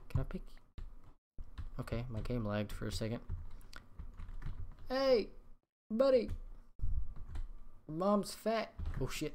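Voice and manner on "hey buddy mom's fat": mock taunting call. Gamer goading a mob with a mom insult, high taunting call. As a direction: pitch it way up and call out teasingly.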